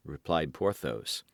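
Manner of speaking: plain reading voice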